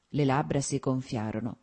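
ordinary delivery, nothing marked

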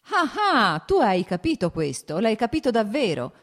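high, loud burst of delight; joyful cry. A narrator's reading. Brother delighted at being understood, joyful cry. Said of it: "gridò con gioia Nikolai".

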